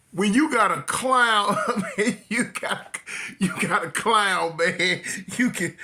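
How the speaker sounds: slow words squeezed out through laughter; trying to talk through the giggles; laughing while talking. Real, not acted. Telling viewers about the clown while laughing. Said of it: "y'all got to excuse me".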